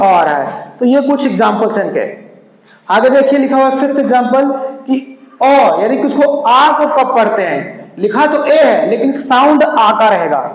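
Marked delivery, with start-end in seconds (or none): none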